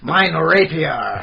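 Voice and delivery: very slow, drawn-out pompous cry; mock medieval declaration. Knight naming his stolen blade, slow mock medieval. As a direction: stretch it out like a stage knight. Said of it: "slip into that medieval".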